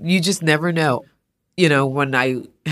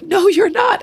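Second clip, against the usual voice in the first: much higher and louder, no, you're not; disbelieving cry; 7 dB louder than usual. Retold or imitated. The woman meets the artist, high loud disbelief. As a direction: shriek her happy disbelief. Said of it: "She's like".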